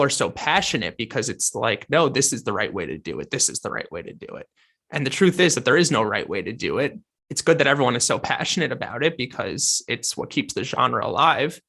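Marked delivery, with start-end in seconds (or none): none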